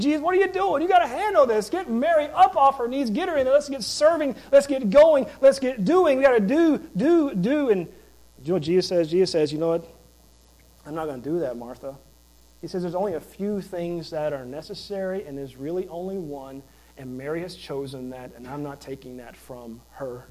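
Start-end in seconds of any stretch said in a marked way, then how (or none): 0.0-7.7 high, loud, frantic complaining; much higher, louder, pleading rush at Jesus; Martha in meltdown mode. Retold or imitated. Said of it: "like, meltdown mode". 9.5-20.2 quiet, gentle correction, growing softer; Jesus calmly answers the frantic Martha, much softer; calm reply. Retold or imitated.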